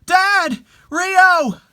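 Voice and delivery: high, loud, overjoyed shouts; joyful calling out. Acted. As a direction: call out to loved ones come back. Family cries out to returned dead.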